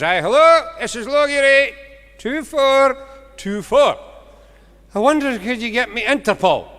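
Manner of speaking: put-on high, loud old farmer calling slowly; a bumbling old farmer shouting down the line; old farmer on the phone. Old farmer shouting down the phone to the operator.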